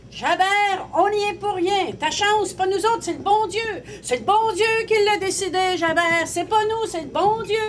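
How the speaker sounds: high-pitched call, sustained to the end; shouting. Acted. Villagers shut indoors crying out to Javert, shouting high. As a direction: cry it out insistently, pitched well up. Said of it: "puis il lui criait".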